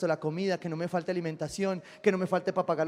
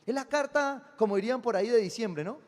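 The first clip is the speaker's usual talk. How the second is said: high, loud preacher's call; preaching at full force; 8 semitones higher, 7 dB louder than usual. Acted. Pastor preaching to his congregation, loud and high. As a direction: proclaim it to the crowd, raised and ringing. Pastor urging listeners to write.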